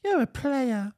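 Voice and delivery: high, mocking voice teasing a player; sing-song mockery of a cocky man; mock boast. Friend mocking a cheater's boast, high and teasing.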